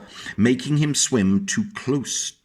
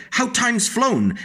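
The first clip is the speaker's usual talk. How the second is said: flock greeting; 11 semitones higher, slower than usual. A narrator's reading. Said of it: "who said".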